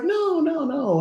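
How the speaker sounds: high, drawn-out soothing denial; acted-out placating in a high pitch; high reassurance. Mother placating her suspicious daughter, high reassurance.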